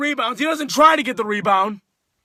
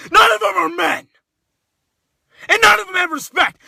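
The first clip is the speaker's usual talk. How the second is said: angry higher shout; 6 semitones higher than usual. Real, not acted. Fan yelling at rival team.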